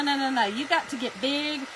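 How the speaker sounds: usual speaking voice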